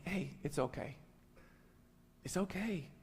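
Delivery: softly calming a husband down; quiet, gentle reassurance; soothing. Retold or imitated. Wife calming her husband down, soft and soothing. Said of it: "she's gotten really good at telling me".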